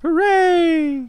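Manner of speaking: high, bright shout of joy; excited cheer. Real, not acted. Cheering in reply.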